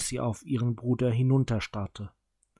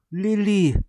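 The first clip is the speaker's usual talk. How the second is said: much higher and louder cry of the name; calling out; 9 semitones higher, 8 dB louder than usual. A narrator's reading. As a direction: call her name out sharply. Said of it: "rief Josu".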